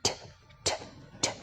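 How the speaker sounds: "T" crisp and aspirated; t sound demo. Shown as a demonstration. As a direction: flick "t" off the gum ridge. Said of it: "t sound".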